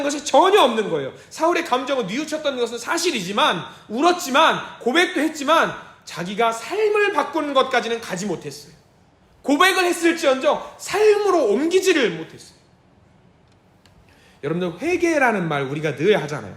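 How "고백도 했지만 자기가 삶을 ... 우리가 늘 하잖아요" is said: usual speaking voice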